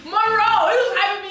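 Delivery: laughing. Real, not acted. Laughing, calling out to Monroe.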